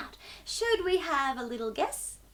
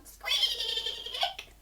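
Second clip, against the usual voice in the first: very high squeak breaking into a laugh; giggly mouse squeak; 5 dB quieter than usual. Shown as a demonstration. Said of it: "the sound that a mouse makes".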